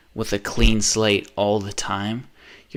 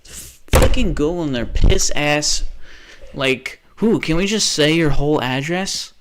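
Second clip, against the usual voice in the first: furious; 4 semitones higher, 5 dB louder than usual. Real, not acted. Raging at Google.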